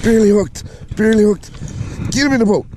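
high, keyed-up urgency; tense excitement mid-catch; urgent. Real, not acted. Urgently landing a barely hooked fish.

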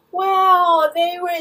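high-pitched ridicule of her babbling; mock crackhead. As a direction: mimic a rambling excuse-maker in a high voice. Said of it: "crackhead syndrome".

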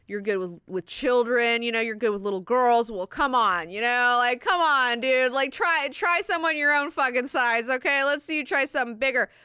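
heated, mocking challenge, retold with force; pissed-off taunting. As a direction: imitate a furious man daring a bully. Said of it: "He's so fucking pissed".

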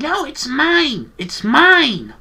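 very high, stretched-out mock tantrum. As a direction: imitate a kid's fit, squealing and dragging out the words. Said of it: "like, throwing a fit".

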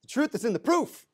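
proof lifted high at the end of the rhyme; rhyme proclaimed. Shown as a demonstration. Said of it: "You like that?".

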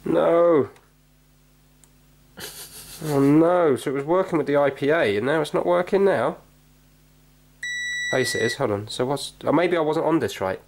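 ordinary talk, nothing marked

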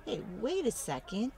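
plain reading voice

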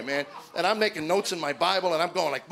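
usual speaking voice

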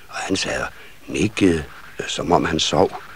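ordinary delivery, nothing marked

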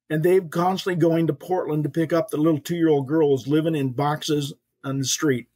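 ordinary delivery

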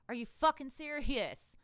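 normal talking voice

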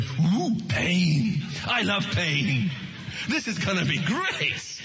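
slow, relishing, with a laugh on the words; hammed-up masochist, slow and chuckling; mock masochist. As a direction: savour the pain like a creepy thrill-seeker. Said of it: "some kind of really weird masochists and they were going".

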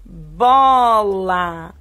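loud, stretched-out teacher reading; drawn-out reading. Shown as a demonstration. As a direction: sound it out loud and long for the class. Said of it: "leia aí pra prof".

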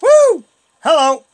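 high loud woo; excited cheer. Real, not acted. Gleeful cheer.

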